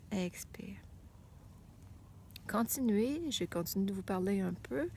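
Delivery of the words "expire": whispered breath cue. Shown as a demonstration.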